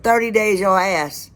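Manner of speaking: high, loud yell thrown right back; retold yell. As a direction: shout it back at him, indignant. Retorting to the freeloader.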